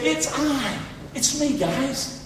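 soft, slow reassuring voice; gentle reassurance. Pretend. Jesus reassuring the disciples in the storm, softly. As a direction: speak softly as Jesus calming them. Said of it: "He said".

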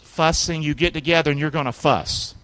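ordinary delivery

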